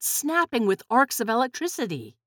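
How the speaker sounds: plain reading voice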